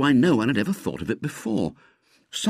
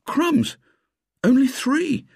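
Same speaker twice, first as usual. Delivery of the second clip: high-pitched yelp of surprise; startled; slower than usual. Acted. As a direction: blurt it out in high disbelief. Startled by the early hour.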